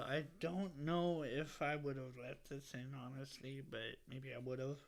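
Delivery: usual speaking voice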